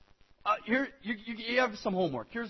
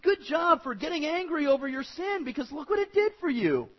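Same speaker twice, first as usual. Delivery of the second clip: raised, congratulatory pitch; praising; 6 semitones higher than usual. Retold or imitated. Pastor voicing Paul to the Corinthians, praising. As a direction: commend them, voice lifted. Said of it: "Paul was saying, "Good job".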